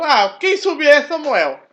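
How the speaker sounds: mock-frantic king demanding answers; distraught Saul. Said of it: "ele tava todo transtornado".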